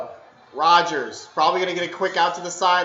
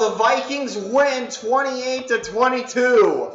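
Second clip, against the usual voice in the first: happy win call; 6 semitones higher, 5 dB louder, slower than usual. Real, not acted. Repeating the win.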